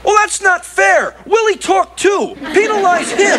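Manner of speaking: high-pitched complaint; indignant protest. Acted. Alf contests the wife's countdown, indignant.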